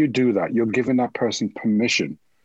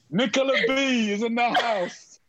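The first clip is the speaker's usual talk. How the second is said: hype announcer; 13 semitones higher, slower than usual. Pretend. Hyping up the host by name.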